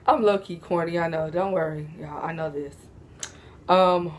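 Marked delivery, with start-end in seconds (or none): none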